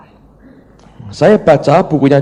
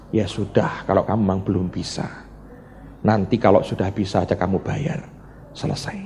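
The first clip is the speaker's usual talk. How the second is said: low, softer quoted reply; resigned acceptance, imitated; 4 semitones lower, 6 dB quieter than usual. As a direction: lightly voice a creditor quietly letting it go. Creditor quietly lets debtor off. (menirukan penagih yang pasrah, pelan)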